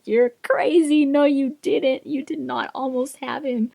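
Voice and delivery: retold exasperated retort. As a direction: argue back as the incredulous other party. Retold fight, man arguing back.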